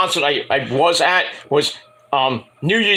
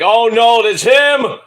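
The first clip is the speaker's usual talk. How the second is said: louder, mock-horrified recognition; mock alarm; 6 semitones higher, 6 dB louder, much slower than usual. Singer spotting the fan at a meet and greet, mock alarm. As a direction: feign alarm at spotting him. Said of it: "she's like".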